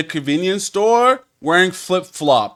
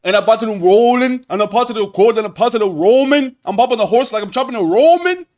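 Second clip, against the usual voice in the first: higher, louder mock Scouse accent; 6 semitones higher, 4 dB louder, faster than usual. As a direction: put on an exaggerated Scouse rap voice. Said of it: "that's my [name] i-, impression".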